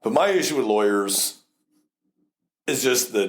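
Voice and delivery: usual speaking voice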